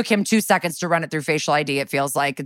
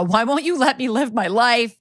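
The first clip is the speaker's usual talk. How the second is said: mock scream; 6 semitones higher than usual. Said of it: "Kim starts screaming at Amanda".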